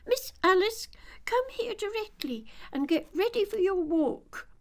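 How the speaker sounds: high, loud calling nurse voice; bossy nurse. Acted. Nurse summoning Alice for her walk, high and loud. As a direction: call Alice in like a stern nurse. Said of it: "she began fancying the sort of thing that would happen".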